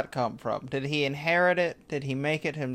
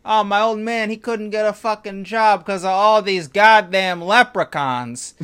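loud, high hammed-up wiseguy gripe; Italian guy complaining; 6 semitones higher, 8 dB louder than usual. Italian wizard griping about his father's job, loud wiseguy gripe. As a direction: gripe like a sore old Jersey guy. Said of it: "He'll be like".

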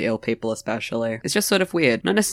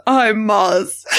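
slow, high, overjoyed imitation; mock happy; 7 semitones higher than usual. Teasing a co-host's happy intro, mock happy. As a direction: stretch it out in mock delight. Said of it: "I've never heard you sound that happy".

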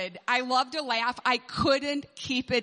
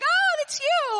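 put-on much higher, louder child voice; imitating the kids; 8 dB louder, slower than usual. Kids realising the teacher was behind the puppet, high squeal. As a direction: squeal like a surprised little kid.

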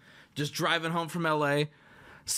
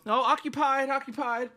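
small, higher sheepish call; 5 semitones higher than usual. Retold or imitated. Caught on the toilet by pounding, a sheepish call. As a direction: call it out meekly, pitched up. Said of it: "sheepishly go".

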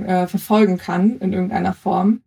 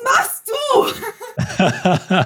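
high-pitched, drawn-out mock shout; retold startled call; much slower than usual. Athlete retells Hans-Jörg calling to her at the water jump, mock shouting. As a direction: imitate someone calling out in disbelief, high and stretched. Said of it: "der steht da so".

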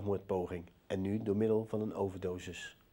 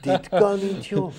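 surprised; 8 semitones higher, 10 dB louder, slower than usual. Retold or imitated. Shocked at the surprise.

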